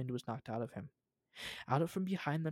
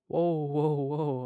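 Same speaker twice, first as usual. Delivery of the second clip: alarmed whoa; 8 dB louder, much slower than usual. Acted. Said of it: "his usual confident tone wavering".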